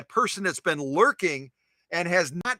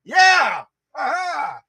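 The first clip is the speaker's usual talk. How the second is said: excited shout; 10 semitones higher, 7 dB louder than usual. Real, not acted. Host saying good morning to new viewer, shouting. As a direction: shout the name long and high, then whoop.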